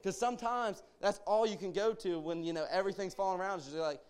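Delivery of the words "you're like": ordinary delivery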